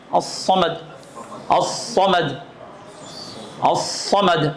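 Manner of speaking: الصمد with a thick emphatic ص; heavy saad in samad. Shown as a demonstration. Teacher drilling a Quranic word with صاد. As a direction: weigh down the ص in الصمد. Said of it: "ہاں، صاد ہے".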